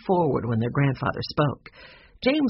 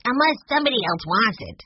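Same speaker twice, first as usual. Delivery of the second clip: put-on higher-pitched polite little boy; 7 semitones higher than usual. A narrator's reading. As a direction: add it politely, voice lifted. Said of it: "he added politely".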